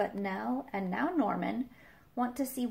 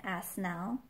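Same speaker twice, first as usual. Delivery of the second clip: plain reading voice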